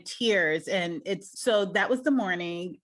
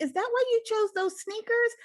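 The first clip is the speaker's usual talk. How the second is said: playful teasing; 12 semitones higher than usual. Retold or imitated. Mom teasing about sneakers.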